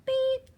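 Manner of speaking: high, short horn beep; little truck's beep. Acted. Answering what the truck says, high beep. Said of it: "What does the little blue truck say?".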